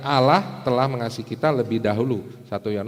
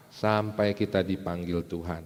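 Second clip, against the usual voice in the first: ordinary talk, nothing marked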